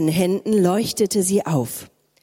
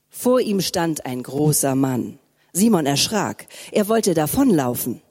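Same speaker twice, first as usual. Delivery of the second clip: ordinary talk, nothing marked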